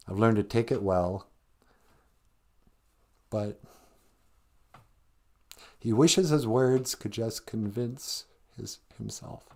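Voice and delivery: holding back tears while retelling the lyric; choked up. Real, not acted. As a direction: voice catching and fading to a breath on the last word. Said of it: "You just got choked up".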